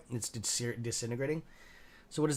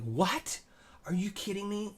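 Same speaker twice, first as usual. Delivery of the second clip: retold shocked disbelief; 7 semitones higher, slower than usual. Retelling the doctor's news to the viewers, in startled disbelief. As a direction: pitch jumps high on a mock startled what.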